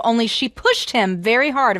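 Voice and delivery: ordinary delivery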